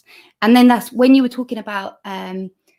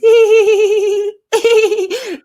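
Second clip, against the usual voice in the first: high, loud tittering giggle; shy smiling laughter; 13 semitones higher, 7 dB louder than usual. Shown as a demonstration. Coach showing how to show your smile, shy laughter. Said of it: "show your smile like this".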